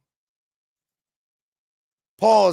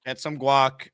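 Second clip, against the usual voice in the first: usual speaking voice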